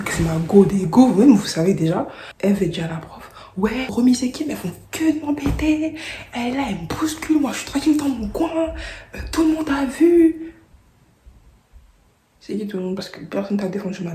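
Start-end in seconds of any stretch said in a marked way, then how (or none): 3.6-10.5 mock victim, much higher; whining complaint. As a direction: whine like the innocent one. Eve se plaint à la prof. (Eve se plaint à la prof, victime geignarde très aiguë)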